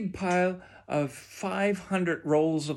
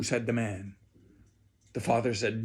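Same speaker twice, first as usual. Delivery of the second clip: usual speaking voice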